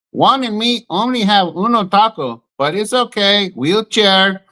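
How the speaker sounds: hammed-up Mexican accent for a meme joke; mock Mexican accent. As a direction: deliver the punchline in a Mexican accent. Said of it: "if you say it in the right accent".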